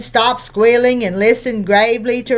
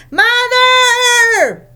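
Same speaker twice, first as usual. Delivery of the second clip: long, high, sing-song shriek; child's yell; 12 semitones higher, 3 dB louder than usual. Acted. A twin shrieking for mother from upstairs. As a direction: call out for mother at full pitch.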